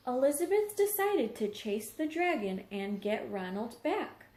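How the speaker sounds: ordinary delivery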